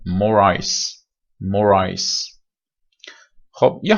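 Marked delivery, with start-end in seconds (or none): 0.1-2.4 more ice with the r carried onto ice; linked more ice. Shown as a demonstration. To the class, more ice linked smoothly. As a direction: link the r into ice, like "mo-rice". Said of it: "اگر بخوایم بهتر کانکتش بکنیم".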